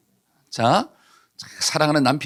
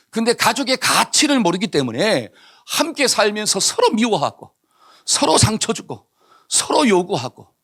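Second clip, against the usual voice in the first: fervent preaching; 4 semitones higher than usual. Acted. Preacher presses the point.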